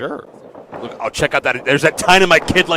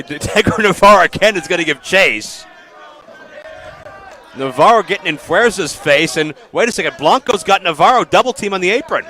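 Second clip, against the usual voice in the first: rising excited play-by-play, louder and higher; lucha libre announcer; 5 semitones higher, 4 dB louder than usual. Real, not acted. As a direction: call the action as it breaks out. Live call of a ringside brawl.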